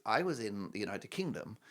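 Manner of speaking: ordinary talk, nothing marked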